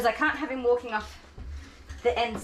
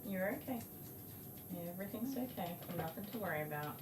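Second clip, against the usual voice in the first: soothing; 16 dB quieter than usual. Real, not acted. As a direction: hush and reassure a squirming pet. Soothing dog while grooming.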